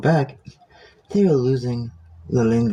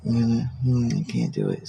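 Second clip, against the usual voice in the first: normal talking voice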